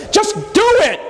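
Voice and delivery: high, exasperated outburst as God; fed up, high and sharp. Pretend. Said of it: "Just say".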